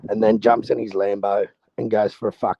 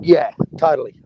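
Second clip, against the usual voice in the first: higher, louder burst of agreement; eager yes; 5 semitones higher, 5 dB louder than usual. Real, not acted. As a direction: jump in keen to agree.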